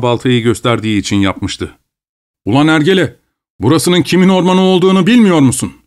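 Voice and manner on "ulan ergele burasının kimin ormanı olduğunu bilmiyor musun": higher, harsher voiced overseer yelling at a peasant; angry scolding. A narrator's reading. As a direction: bark it up high in fury. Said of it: "Rıcevetski çok öfkelenmişti".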